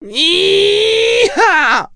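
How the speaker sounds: very high shrieked cry of effort; straining yell. A narrator's reading. Mia pushes out the baby, shrieking. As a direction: shriek high while pushing. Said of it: "hurla-t-elle".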